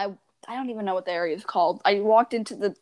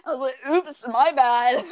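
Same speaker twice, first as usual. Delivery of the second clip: giggly oops, louder at the end; laughing sheepish apology; 4 semitones higher, 5 dB louder than usual. Retold or imitated. Kid laughs off pushing her sister, giggly apology. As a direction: shrug it off through laughter.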